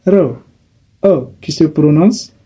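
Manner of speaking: ordinary talk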